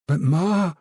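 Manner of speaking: put-on a young daughter cutting in; lightly voiced girl protesting.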